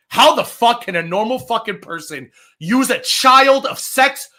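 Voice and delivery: usual speaking voice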